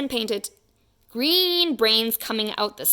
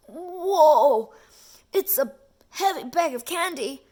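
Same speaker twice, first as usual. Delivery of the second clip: higher, louder laughing whoa; surprised at the weight; 6 semitones higher, 4 dB louder than usual. Acted. Policeman surprised by the heavy candy bag. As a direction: marvel at how heavy it is. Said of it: "said the policeman".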